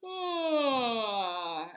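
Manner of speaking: loud open yawn on ah; vocalized yawn. Shown as a demonstration. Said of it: "And one more time".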